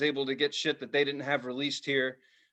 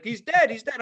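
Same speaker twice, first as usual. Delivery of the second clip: high, loud taunt; taunting repeat; 8 semitones higher, 4 dB louder than usual. Retold or imitated. Bully taunting the boy, high mocking repeat. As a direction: needle him like a brat. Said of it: "this kid's like".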